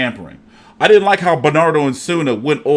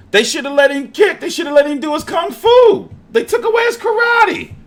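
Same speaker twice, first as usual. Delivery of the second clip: hammed-up indignation, rising higher each line; mock outrage; 12 semitones higher than usual. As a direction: pitch climbing way up to a high shout. Mock outrage over Floyd fight.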